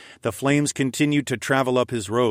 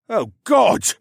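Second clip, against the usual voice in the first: high, loud cry of horror; panicked exclamation; gasping shock; 12 semitones higher, 7 dB louder than usual. A narrator's reading. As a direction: gasp it out in sudden terror. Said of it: "Luca gasped".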